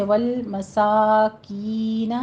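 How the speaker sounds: والمساکین slowly, aa and ee held alike; measured chant of والمساکین; equal long vowels. Shown as a demonstration. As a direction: stretch "saa" and "kee" evenly in والمساکین. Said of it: "جتنا آ اتنا ای کی sound".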